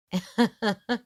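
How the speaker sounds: a quick amused laugh; short laugh. A narrator's reading. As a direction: laugh it off lightly. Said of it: "he laughed".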